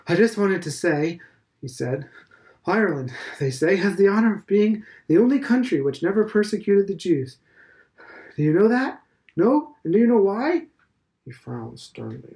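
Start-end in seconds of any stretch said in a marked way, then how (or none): 0.1-10.7 higher and louder, pushing out the words; breathless and eager. A narrator's reading. Old headmaster catching Stephen at the gate, breathless. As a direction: catch your breath and press on, proud of the point. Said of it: "breathing hard and swallowing his breath".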